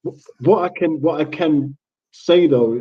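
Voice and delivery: ordinary delivery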